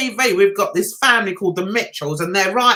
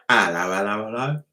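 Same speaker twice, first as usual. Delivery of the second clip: very low, put-on hello; silly greeting; 5 dB quieter than usual. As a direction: drop right down into a playful greeting.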